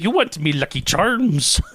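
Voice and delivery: high, slow Irish lilt; cartoonish Irish cereal-advert voice; mock Irish accent. Shown as a demonstration. Host demonstrating Irish for co-host, cartoonish Irish accent. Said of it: "The Irish is just a wee bit".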